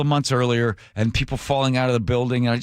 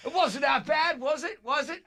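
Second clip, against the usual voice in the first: very high, softer mock coaxing voice; mock-sweet falsetto; imitating cheery guide; 14 semitones higher, 5 dB quieter than usual. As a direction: squeak it like a falsely reassuring guide. Guide greets him after landing.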